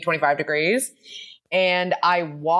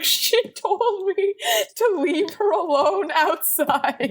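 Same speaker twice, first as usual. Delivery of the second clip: put-on higher, slow, baffled boyfriend voice; mimicking Jeff; 11 semitones higher, slower than usual. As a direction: play Jeff, helpless and confused. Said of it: "And Jeff was like".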